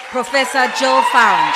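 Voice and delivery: big booming name call; announcer shout. Acted. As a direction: belt the name out to the hall. Announcing the award winner.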